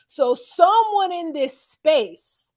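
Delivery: drawn-out, high, pointed accusation; slow accusing emphasis. Retold or imitated. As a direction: lean on every word as if naming a liar. Marshall accusing the house.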